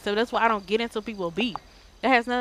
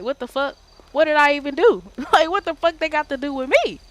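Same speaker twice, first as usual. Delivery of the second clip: rising higher and louder in disbelief, laughing at the end; exasperated outburst; 6 semitones higher, 5 dB louder than usual. Retold or imitated. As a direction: let the outrage climb into a laugh. Retelling being stabbed in game.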